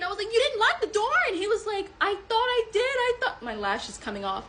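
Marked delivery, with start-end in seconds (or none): 0.3-1.2 high, held-back mock yell; retold yell. Woman blaming him for the unlocked door, mock yell. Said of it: "I was yelling at Pete". 2.0-3.4 high, softened mock excuse; defensive man imitated. Man excusing the unlocked door, high mock defensiveness. Acts out his defensive reply.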